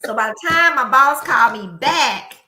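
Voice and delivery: ordinary delivery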